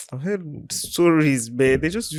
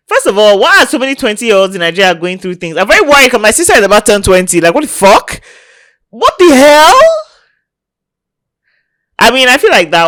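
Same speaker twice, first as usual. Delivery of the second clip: outraged rant; 7 semitones higher, 12 dB louder, faster than usual. Real, not acted. Outraged rant about twenty-year-olds.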